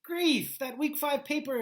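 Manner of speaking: high, loud "good grief" panic; sudden dismay at a forgotten deadline; exasperated groan. Retold or imitated. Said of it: "you're like".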